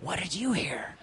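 soft whisper back to her; hushed whisper with a laugh in it. Retold or imitated. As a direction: keep it under your breath. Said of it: "I whispered back to her".

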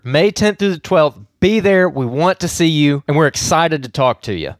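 loud, upbeat radio promo; ad read. Acted. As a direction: pitch the event like an announcer.

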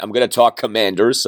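ordinary delivery

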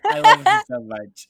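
high, loud, slow gush of love; gushing adoration. Real, not acted. Fan gushes to cohosts about a beloved singer, swooning. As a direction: swoon over her loudly and slowly.

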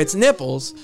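ordinary talk, nothing marked

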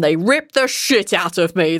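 usual speaking voice

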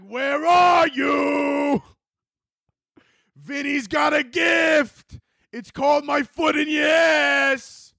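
high drawn-out mobster holler; menacing taunt. Acted. Mobsters coming with a bat, menacing singsong call. Mobster calls out while hunting anxiety.

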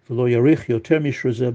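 ordinary talk

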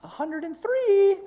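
high falsetto guilt-tripping conscience; angel on the shoulder. Acted. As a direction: coo it very high, stretching the phrases. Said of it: "you hear the one voice that says".

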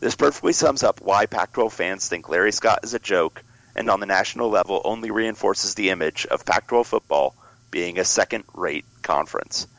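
ordinary talk, nothing marked

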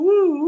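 high whooping woo; woo sound effect. Shown as a demonstration. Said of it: "maybe a woo".

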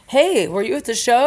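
ordinary talk, nothing marked

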